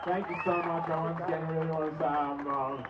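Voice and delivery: hushed thanks from a speaker who is shaking. Real, not acted. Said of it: "I'm honestly shaking".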